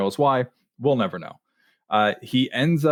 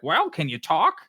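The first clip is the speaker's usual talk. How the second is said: high, shrill mock Heyman voice; a self-mocking, squeaky Heyman; Paul Heyman impression; 6 semitones higher than usual. Host imitating Heyman calling Austin: shrill mock Heyman. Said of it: "a terrible Paul Heyman impression".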